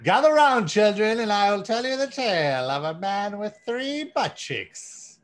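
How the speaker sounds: put-on gather the children like an old bard; slow wise elder. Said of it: "a tale from the medieval times".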